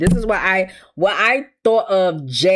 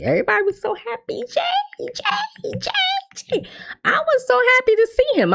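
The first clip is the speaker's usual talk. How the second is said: high, hammed-up weepy squeal over Jay; mock fangirl crying; faster than usual. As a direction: gush like a sobbing superfan.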